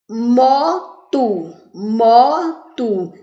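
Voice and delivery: "moto" in two drawn-out beats; syllable by syllable. Shown as a demonstration. Teacher giving a two-syllable example, "moto" pulled apart. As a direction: split it clearly into mo, to. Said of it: "Tem quantas sílabas?".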